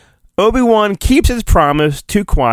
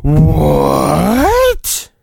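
surprised cry. Acted. Jar Jar-style surprised outburst.

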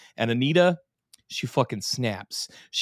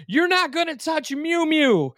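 squeaky falsetto quote of a possessive man; high mock character voice; 3 dB louder than usual. Podcast host quoting a villain about his cat, squeaky falsetto. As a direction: pitch way up, clutching the cat.

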